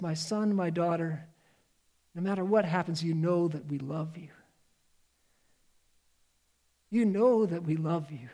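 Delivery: quiet loving parent's promise; tender parent. Acted. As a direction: say it softly, like a parent hugging their child goodbye. Parent's farewell words to child.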